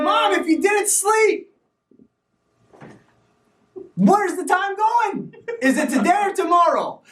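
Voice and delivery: high, slow, drawn-out question to mom; high puzzled question. Retold or imitated. Son asks mom where the time goes, high and slow. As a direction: stretch it up high and slow, wondering aloud.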